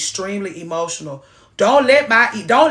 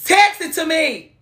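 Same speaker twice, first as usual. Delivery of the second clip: higher and louder, a sudden mock outburst; mock raised voice; 7 semitones higher, 4 dB louder, slower than usual. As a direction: imitate him going up an octave.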